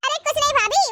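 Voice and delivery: normal talking voice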